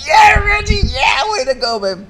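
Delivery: high, loud whoop of joy; jubilant shout. Retold or imitated. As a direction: yell it like you're jumping for joy. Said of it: "I'm jumping up and down".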